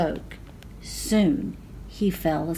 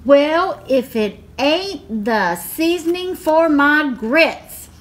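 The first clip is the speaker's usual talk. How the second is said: loud, higher gleeful giant shout; 6 semitones higher, 4 dB louder than usual. A narrator's reading. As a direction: crow over the catch. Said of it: "the giant shouted".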